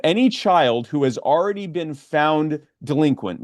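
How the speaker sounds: slow, emphatic explanation; slow and deliberate. Real, not acted. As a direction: spell out the fine point slowly. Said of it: "I'm gonna say it slowly".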